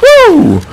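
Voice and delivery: high, sliding victory woo; relieved whoop. Real, not acted. Celebrates winning the hard fight.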